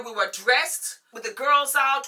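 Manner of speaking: normal talking voice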